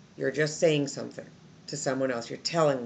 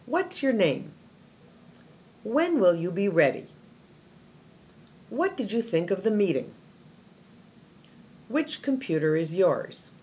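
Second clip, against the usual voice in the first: questions falling in pitch on "name", "ready", "meeting", "yours"; confident questions that drop at the end, no lift on "name" or "yours"; 2 semitones higher than usual. Shown as a demonstration. Teacher models questions without yes-no answers, falling tone. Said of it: "you still have a down tone".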